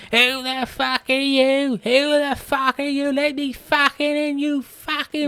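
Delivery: high, slow furious screeching; mock screaming. Vicky banging on the Mitchell door, high slow screeching. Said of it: "they're like".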